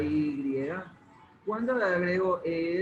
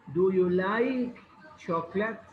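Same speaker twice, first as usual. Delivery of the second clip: slow model question; slower than usual. Shown as a demonstration. Teacher models English question slowly.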